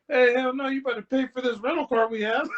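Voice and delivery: high, loud mock objection with a laugh; laughing, high-pitched retold protest; imitated protesting dad. Dad butting in on cash gift.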